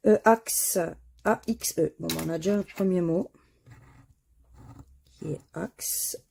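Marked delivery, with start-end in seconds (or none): 5.1-6.2 whisper. Real, not acted. Confirming found word.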